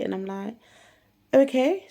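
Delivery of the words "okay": bright okay. Retold or imitated. Agreeing to teacher.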